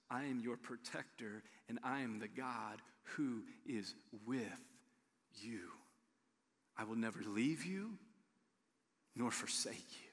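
low, soft, hushed reassurance; God's tender promise. Acted. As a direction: speak as God comforting a frightened child.

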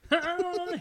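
high bubbly "Hey"; chirpy talk show host greeting; Rosie O'Donnell impression. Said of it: "her inflections are kinda like".

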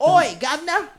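high, loud mock cockney Oi, governor; a hammed-up London greeting; British accent. Friend teasing the group in a mock cockney accent. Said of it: "just making sure that's what you said".

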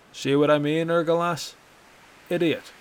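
mocking jab. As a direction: rub it in with a smirk. Bracknell mocking to the guard.

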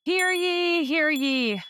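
high crier call; town crier. Acted. Sheriff's proclamation call repeated.

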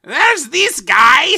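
slow, loud, high goofy voice; silly voice. Shown as a demonstration. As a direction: do the worst voice you can think of. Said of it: "the worst voice I can think of".

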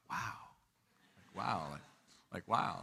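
soft, low retold wow; hushed amazement. Young speaker seeing everybody stand up to pray, hushed amazement. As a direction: replay quiet disbelief, dropping the voice. Recalls his astonishment at being followed.

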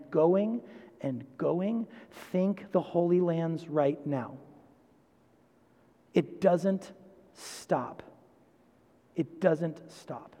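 slow, soft preaching with long pauses; hushed, weighty. Real, not acted. Preacher to congregation on endless cycles of vengeance, hushed and weighty. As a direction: let each phrase sink in, very quiet and slow.

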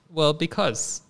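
normal talking voice

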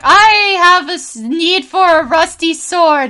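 put-on high, slow little kid voice. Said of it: "there was this kid coming up to me and was like".

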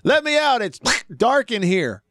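hammed-up plea from the dark box; half-mock yell from inside the coffin. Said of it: "The recording said".

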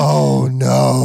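loud, hammed-up dismayed no; mock horrified cry. Husband realising it's menopause, a hammed-up loud cry of dismay. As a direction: fake a dramatic horrified realisation.

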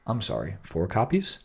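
soft late-night FM DJ voice. Shown as a demonstration. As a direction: say it quietly, like a radio DJ easing into a question. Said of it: "Go in with the F M D J voice".

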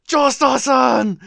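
high, drawn-out mock cry; Avdol's shout. Host acting out a companion crying to his friend, dramatic shout. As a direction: call out the name in slow, stretched alarm. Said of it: "we get Avdol shouting".

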